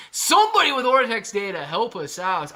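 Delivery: high, loud appeal; calling out. Real, not acted. Streamer asks chat for data, calling out high and loud. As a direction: call out loudly for anyone who can help.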